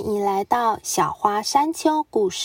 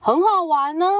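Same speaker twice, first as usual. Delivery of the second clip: calling out; 6 semitones higher, faster than usual. Acted. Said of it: "他们大喊".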